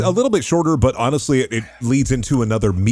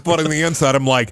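usual speaking voice

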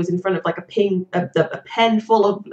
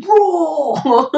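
weird dinosaur roar, high and loud; Gordon Ramsay; 10 semitones higher, 6 dB louder than usual. Retold or imitated. Chef complaining food is underdone, mock dinosaur roar. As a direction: bellow it like an outraged chef turned dinosaur. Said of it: "really weird dinosaur impression".

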